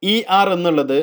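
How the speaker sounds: normal talking voice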